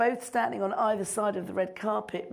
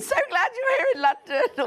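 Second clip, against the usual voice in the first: much higher and louder naff gush; gushing fan; 13 semitones higher, 5 dB louder than usual. Retold or imitated. Fans greeting Bruce on the carpet, gushing high. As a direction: greet your hero too eagerly. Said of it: "or something so naff".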